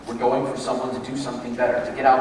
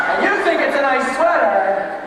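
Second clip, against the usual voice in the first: much higher, loud, slow scoff; indignant disbelief; 11 semitones higher, 7 dB louder than usual. Pretend. [name] scoffing to herself at the compliment.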